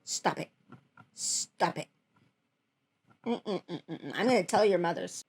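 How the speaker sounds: hushed, low telling-off; mock scolding. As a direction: scold them playfully under your breath. Mock-scolds the meme makers.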